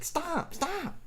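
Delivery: high, thin panicked stop stop; mock freaking out. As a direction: squeak it out like someone cornered and scared. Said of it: "he'd start freaking out".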